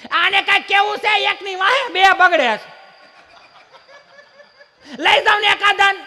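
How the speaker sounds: high, loud mock mother laughing; shrill-free high nagging wife voice. Mother complaining to father. (બાપુને ફરિયાદ કરતી બાનો ઊંચો મોટો અવાજ)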